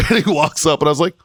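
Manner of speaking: ordinary talk, nothing marked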